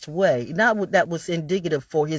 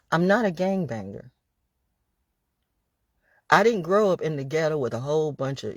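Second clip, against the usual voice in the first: usual speaking voice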